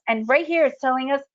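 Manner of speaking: ordinary talk, nothing marked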